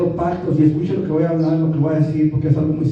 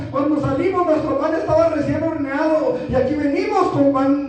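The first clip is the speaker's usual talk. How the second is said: pleading envoys; 10 semitones higher than usual. Acted. Disguised strangers begging Josué for a pact, pleading. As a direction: plead insistently in a raised, ringing pitch.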